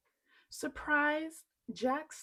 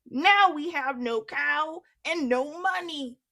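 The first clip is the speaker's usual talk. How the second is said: higher, louder angry complaint; angry mother; 5 semitones higher, 11 dB louder than usual. Acted. Mother scolding Jack about the money, loud. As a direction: throw the loss at him. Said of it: "she said".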